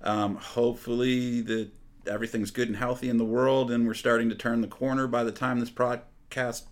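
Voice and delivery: ordinary talk, nothing marked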